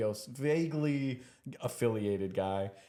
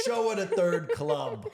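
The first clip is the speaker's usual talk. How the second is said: mock threat; 7 semitones higher, 5 dB louder, slower than usual. Joking threat, slow and loud.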